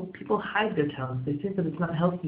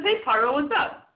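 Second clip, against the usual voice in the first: casual friendly; 9 semitones higher than usual. Pretend. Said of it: "as if it's his friend".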